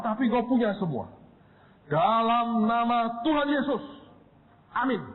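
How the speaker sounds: high, pleading prayer voice; fervent prayer. Retold or imitated.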